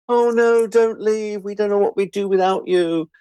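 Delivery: high, syrupy fake pleading; mock gushing plea. Colleagues pleading with him to stay, in a high mock gush. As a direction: gush it like insincere colleagues begging. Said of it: "the whole facile kind of".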